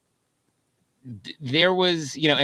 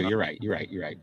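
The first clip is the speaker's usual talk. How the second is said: each repeat softer until almost gone; fading away; 7 semitones lower, 8 dB quieter than usual. Real, not acted. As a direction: trail off, conceding quietly. Conceding, repeating and trailing off.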